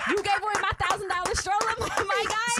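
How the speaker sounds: high, loud, fast indignation; re-live the outrage, breaking into a laugh; still triggered. Acted. Wife challenges husband over her stroller, half-joking and triggered. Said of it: "you're still triggered right now".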